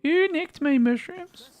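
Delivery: very high, slow put-on voice; silly high voice. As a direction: stretch it out in a squeaky character voice. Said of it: "Who licked her mushrooms again".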